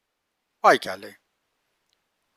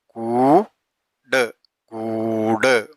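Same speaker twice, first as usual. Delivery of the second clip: slow reading; 2 semitones lower, much slower than usual. Shown as a demonstration. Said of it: "drawn-out reading demo".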